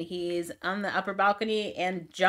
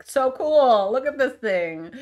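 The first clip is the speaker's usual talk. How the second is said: higher, louder goofy gushing; dumb awestruck Nate; 6 semitones higher, 6 dB louder, slower than usual. Retold or imitated. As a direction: play him as a wide-eyed dope, overexcited. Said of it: "Nate sounds like an idiot".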